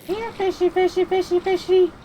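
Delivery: high sing-song call, here fishy; calling the fish. Pretend. Player calling fish toward the line, high and sing-song.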